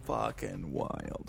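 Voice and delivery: slow, drawn-out whisper; awed whisper. Real, not acted. Reacting to the drowned sandworms, awed whisper. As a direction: let it out slowly under the breath.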